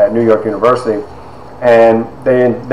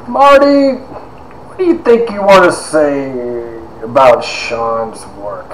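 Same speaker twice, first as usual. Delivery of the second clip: Doctor Stoneburner; 2 semitones higher, slower than usual. Retold or imitated. Professor asking the class about a student's work, eccentric impression. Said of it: "that's how he talked".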